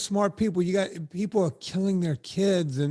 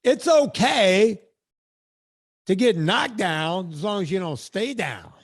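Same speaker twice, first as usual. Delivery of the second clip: preacher platitude; 3 dB louder than usual. Pretend. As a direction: preach it to the congregation.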